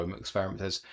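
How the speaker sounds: normal talking voice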